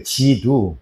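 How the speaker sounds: 지두 repeated low as ji-du; Chinese pronunciation. Shown as a demonstration. Teacher repeating Chinese word for class, 지두 said low. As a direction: drop down on 지두, two syllables ji-du. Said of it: "중국에서는 지두 이렇게 읽어요".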